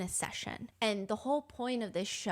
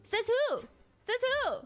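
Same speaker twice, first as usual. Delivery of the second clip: mock retort; 11 semitones higher, 4 dB louder than usual.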